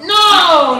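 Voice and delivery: high shouted refusal; loud no. Retold or imitated. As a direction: shout it out, outraged. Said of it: "he said".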